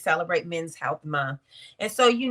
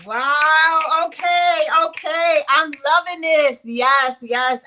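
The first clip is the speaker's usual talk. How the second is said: loud, high-pitched burst of delight; excited praise; 6 semitones higher, 8 dB louder, slower than usual. Real, not acted. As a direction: cheer the poet on, thrilled. Host cheers the finished poem.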